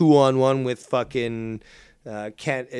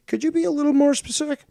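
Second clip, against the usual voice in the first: high mock voice; 13 semitones higher, 3 dB louder, faster than usual. Mock dialogue, high pitch.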